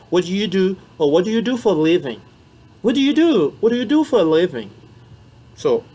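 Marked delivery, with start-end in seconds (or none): 0.1-4.7 What do you do for a living said higher, louder; teaching demo. Shown as a demonstration. Teacher drilling job questions for French learners, high and clear. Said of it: "C'est comme ça qu'on pose en anglais".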